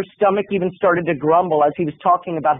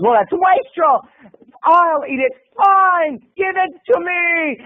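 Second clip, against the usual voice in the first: high, slow, crying mock outburst; hammed-up martyr wail; 9 semitones higher, slower than usual. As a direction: wail it like a put-upon martyr. Martyr roommate cries out.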